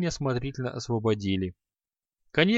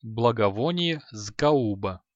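ordinary delivery

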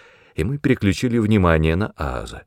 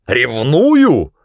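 loud, higher outraged question; incredulous outburst, pitched up; exploding indignation; 5 semitones higher, 6 dB louder than usual. A narrator's reading. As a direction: blow up at the very idea. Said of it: "взорвался Ас".

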